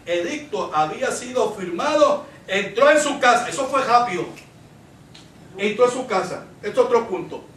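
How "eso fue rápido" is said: ordinary talk, nothing marked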